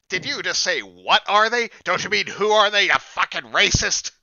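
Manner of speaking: high, loud, indignant gnome voice; angry gnome. Acted. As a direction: squeak out the outrage like an offended gnome. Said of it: "a gnome walking up to this guy".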